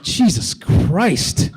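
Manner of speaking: very slow, drawn-out disbelief; appalled reaction. Retold or imitated. Comedian reacting to a gamer's abuse, slow appalled exclamation. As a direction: stretch it out, aghast at the outburst. Stunned reply to gamer's tirade.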